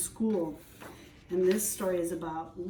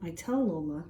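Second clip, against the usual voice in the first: plain reading voice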